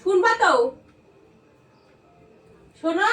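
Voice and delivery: high, loud demand; scolding. Acted. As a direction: call him out sharply. Starting the staged quarrel.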